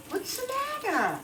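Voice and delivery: husband's question. Retold or imitated. Husband asks her calmly after procedure.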